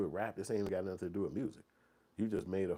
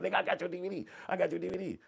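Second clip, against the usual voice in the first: excited fan; 11 semitones higher, 3 dB louder, faster than usual. Retold or imitated. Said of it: "They'd be like".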